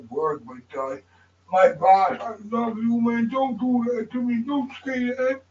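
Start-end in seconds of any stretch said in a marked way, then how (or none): none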